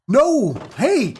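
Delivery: sharp high yell of alarm; high startled yelling; sudden shout. Real, not acted. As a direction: shout to stop the escaping villager. Villager escaping, shouting at it.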